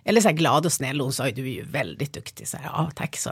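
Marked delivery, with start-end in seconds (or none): 2.7-3.3 soft whisper, meek and small; whispered thanks. Retold or imitated. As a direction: mumble thanks, a bit crushed. Retells her meek reply to praise. (viskar tyst och lite snopet)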